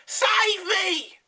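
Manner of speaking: high, loud witch scream for help. A narrator's reading. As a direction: shriek as she flies off. Mrs Twit flying off.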